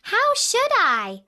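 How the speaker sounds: put-on high, loud, slow girl's voice; bewildered little girl asking back; Dorothy's innocent answer. A narrator's reading. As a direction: ask it like a bewildered child. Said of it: "answered Dorothy".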